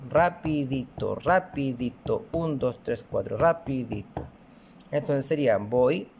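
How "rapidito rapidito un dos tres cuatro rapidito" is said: spoken slowly, syllable by syllable; slow demo. Shown as a demonstration. Teacher slowly modelling rapidito for the class. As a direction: spell out the four even beats. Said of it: "lo enseño como rapidito".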